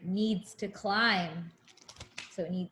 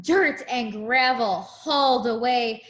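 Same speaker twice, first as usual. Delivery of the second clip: sing-song picture book rhyme; reading to a child; 4 dB louder than usual. Acted. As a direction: read it like a bedtime rhyme.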